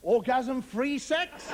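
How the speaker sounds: "Orgasm-free sex" drawn out slowly, laughing; slow laughing punchline. Shown as a demonstration. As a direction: lean on it, slow and amused.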